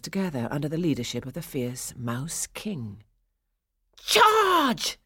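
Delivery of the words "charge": loud, high-pitched war cry; battle yell. A narrator's reading. Mice yell as they rush the toy soldiers, yelled. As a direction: yell it out to launch the attack. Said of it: "they yelled".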